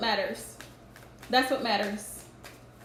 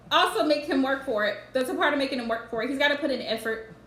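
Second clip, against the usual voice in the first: ordinary talk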